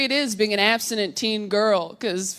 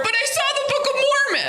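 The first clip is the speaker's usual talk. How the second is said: very high, quick squeal; mock last words; faster than usual. Woman's last words in a joke, high mock squeal. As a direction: squeal it out in a hurry.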